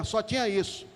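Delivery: normal talking voice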